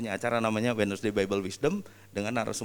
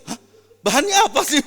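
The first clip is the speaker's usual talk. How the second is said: high, loud startled yelp; startled panic; 14 semitones higher, 11 dB louder than usual. Retold or imitated. Vino suddenly put on stage, high startled yelp. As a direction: blurt it out in shock. His own panicked reply.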